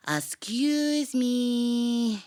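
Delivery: a tiny, high, very slow little voice; little mouse voice. Acted. Little mouse in the grass interrupting the tiger, tiny and slow. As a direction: creep in politely as a small mouse. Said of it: "they heard a little voice".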